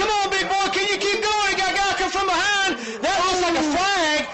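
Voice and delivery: real fan excitement spilling into the call; excited cheering. Real, not acted. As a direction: yell him down the field. Cheering on the lineman.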